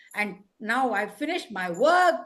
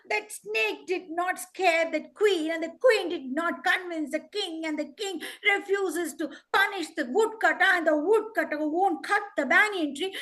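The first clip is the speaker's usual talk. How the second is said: insistent, raised-pitch begging; pleading crow; 3 semitones higher than usual. Acted.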